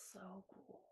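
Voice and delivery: soft whispered echo; whispering. Real, not acted. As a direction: breathe it in a whisper.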